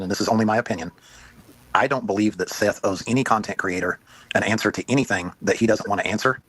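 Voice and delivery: normal talking voice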